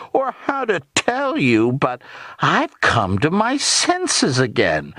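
high, slow mock embarrassment; little old aunt mimicking, nastily sweet; sickening nastiness. A narrator's reading. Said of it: "nastiness in her tone was actually sickening".

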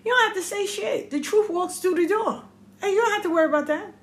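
high-pitched, insistent parental advice; advising the kids. Retold or imitated. Parent to kids about truth coming out, insistent advice. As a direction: act out telling children how it is. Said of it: "I tell my kids".

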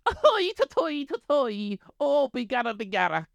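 high, slow, hammed-up stage-Irish voice; mock leprechaun Irish accent, way up high; Conor McGregor as stage Irish. Mocking how Americans hear Conor McGregor, as a hammed-up stage-Irish accent. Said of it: "here's my impression of Conor McGregor".

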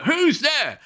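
high mock call into the dark. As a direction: call out searching for someone. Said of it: "calling out".

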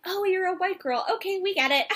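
put-on high, dismissive girly voice; high mock reassurance; mock breezy girls. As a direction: brush it off like it's nothing. Imitating the expected girls' reply.

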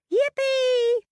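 very high, loud squeal of joy; excited shout. Acted. Little Pig spots the bricks and shrieks with delight. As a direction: let the yippee fly up high and loud. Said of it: "shouted Little Pig".

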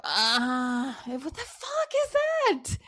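slow, strained mock groan rising very high; mom's bathroom groan. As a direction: ham up mom straining on the toilet.

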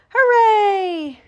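high, loud drawn-out cheer; shouting. A narrator's reading. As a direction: cheer like a whole crowd of animals. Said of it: "shouted the other animals".

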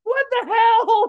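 high, slow, incredulous shout; shocked outburst. Real, not acted. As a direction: blurt it out in disbelief. Shocked reaction to Alabama claim.

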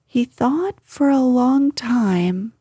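plain reading voice

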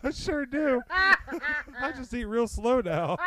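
high, giggling mock boast; high laughing slow drawl. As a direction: laugh through the joke about eating slow. Mock fasting claim, laughing.

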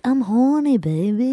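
high, swaggering spy voice; Austin Powers. Retold or imitated. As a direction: kid doing the shagadelic spy. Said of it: "Austin Powers".

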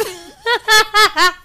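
loud, high burst of laughter. Real, not acted. Fan cracking up after the bath line, loud laugh.

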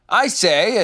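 shouting. A narrator's reading. Rabbit calls round the forest, shouting. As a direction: hail someone out of earshot. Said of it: "turned all round and shouted".

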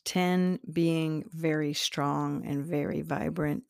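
unhurried meditation voice with long pauses; guided meditation. Acted. Guiding listeners to their sacral chakra, calm meditation voice. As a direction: ease the listener inward, gently paced.

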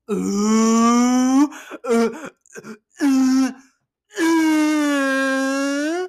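high, loud imitated scandalized oohs; classroom "ooh". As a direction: imitate a whole class hooting "ooh" at someone in trouble. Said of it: "The entire thirty of my peers".

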